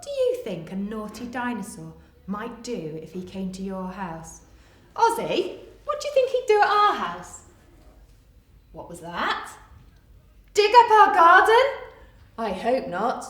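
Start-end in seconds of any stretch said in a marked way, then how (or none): 4.9-7.4 excited question. Acted. Teacher asks puppet, raised voice. 10.5-11.6 loud, high mock-shocked question; surprised echo. Echoes puppet's idea, loud.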